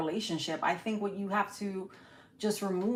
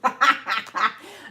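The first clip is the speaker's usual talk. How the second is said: real burst of loud laughter; laughing; 3 semitones higher, 6 dB louder than usual. Real, not acted. Reader laughing because she's been there, real loud laughter. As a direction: laugh out loud at a familiar struggle. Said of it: "I'm just laughing".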